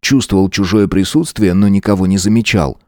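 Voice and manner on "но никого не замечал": ordinary delivery, nothing marked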